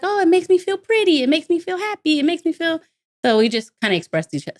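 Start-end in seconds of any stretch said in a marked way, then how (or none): 0.0-2.8 put-on much higher, sweet childlike voice; little girl voice. Little daughter telling mom her feelings, higher sweet voice. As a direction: speak as a happy small girl.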